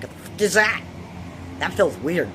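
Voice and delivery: high, loud startled outburst. Retold or imitated. Kid feeling water in her foot, startled loud outcry. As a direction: blurt it out, alarmed and confused. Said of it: "and I'm like".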